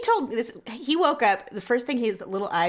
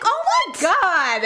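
exasperated mock disbelief; 8 semitones higher, 4 dB louder, slower than usual. Mom exasperated retelling.

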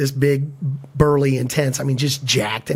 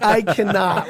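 ordinary talk

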